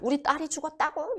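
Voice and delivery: high-pitched shocked question; acted, high cry of disbelief; disbelieving parent. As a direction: imitate a parent reeling at bad news. Parents rush in on hearing news.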